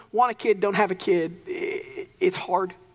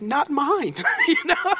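proud laughing quip; 6 semitones higher, slower than usual. Retold or imitated. Retold joke to sister-in-law.

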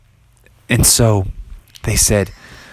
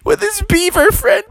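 high, mocking laughing townsfolk; high scoffing crowd voice; mocking laughter. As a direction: jeer at the frog, laughing. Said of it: "everyone's like".

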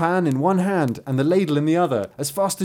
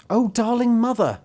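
put-on high, wheedling child's plea; high-pitched pleading bairn; begging child; 8 semitones higher than usual. A narrator's reading. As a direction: coax mother for a treat. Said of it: "they begged for the pancake".

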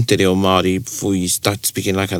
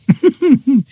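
burst of laughter; 5 dB louder than usual. Real, not acted. Laughing after telling the anecdote.

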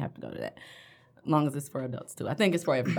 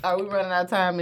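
usual speaking voice

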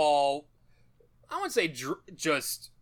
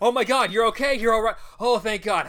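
louder, higher relieved gushing; relieved father; 5 semitones higher, 5 dB louder than usual. Retold or imitated. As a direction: gush with relief over the child.

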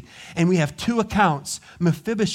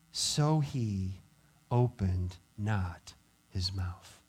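solemn hush; 10 semitones lower, 8 dB quieter, much slower than usual. Acted. Slow closing of scripture.

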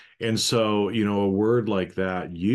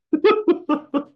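real hearty laugh; 8 dB louder than usual. Real, not acted. Laughing at the joke.

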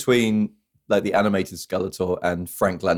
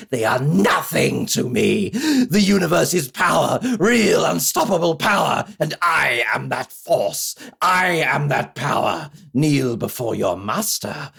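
booming Skeletor; 10 semitones higher than usual. Pretend. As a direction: declaim like a cartoon supervillain. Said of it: "a man who once said".

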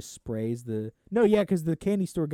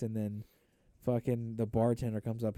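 ordinary delivery

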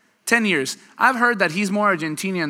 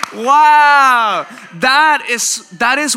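much louder and higher amazed "Wow"; 8 semitones higher, 9 dB louder, much slower than usual. Real, not acted. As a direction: react with loud, high astonishment. Amazed after laughter.

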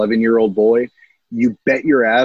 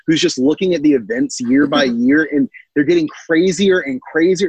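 normal talking voice

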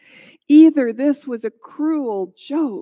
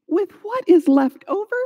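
higher, disbelieving echo on "left over"; raised pitch, can't believe it; incredulous; 6 semitones higher, 4 dB louder than usual. A narrator's reading. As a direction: throw "left over" back as a baffled question. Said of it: "She couldn't believe what he was saying".

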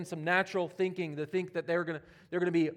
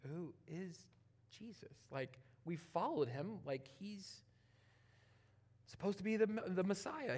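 hushed confused disciple; 10 dB quieter than usual. Acted.

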